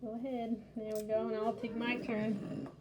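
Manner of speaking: usual speaking voice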